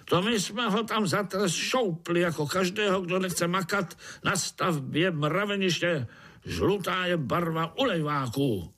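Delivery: high-pitched, insistent overseer voice; scornful overseer. A narrator's reading. Overseer explains the punishment for shirkers, scornful. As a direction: lay down the law in a high voice.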